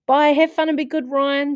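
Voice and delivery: high, loud farewell call; calling goodbye. Acted. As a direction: call after them as they leave. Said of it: "said Missus Evans, waving".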